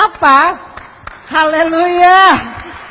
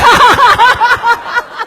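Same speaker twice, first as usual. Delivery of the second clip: hearty laugh; 7 semitones higher than usual. Real, not acted. Preacher cracks up at his joke about Ahok, hearty laugh.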